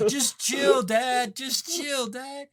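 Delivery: high-pitched mock teenage plea; high teen shout. Teenager calling to dad on the boat, high mock shout. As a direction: call it out high, fading on the repeat. Acting out yelling teenagers at boats.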